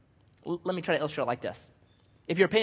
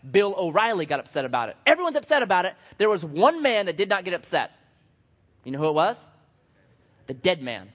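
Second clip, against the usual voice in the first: usual speaking voice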